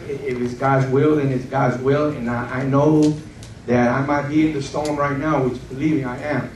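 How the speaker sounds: normal talking voice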